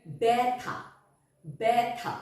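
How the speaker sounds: ব্যথা said as "bætha", with an open e and no doubling; careful ব্যথা starting with an open "bæ"; clear pronunciation demo. Shown as a demonstration. Teacher showing pupils how initial jofola sounds. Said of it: "বয় জফলা".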